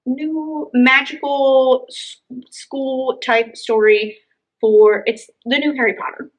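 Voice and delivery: normal talking voice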